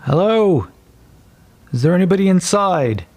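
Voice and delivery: guard demanding the door be opened; King's Guard calling. Acted. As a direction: call out through a barred door with authority. Guard calling through barred door.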